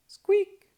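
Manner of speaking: sharp, high, loud mouse squeak. Acted. Narrator imitating the mouse behind the bakery, a high squeak.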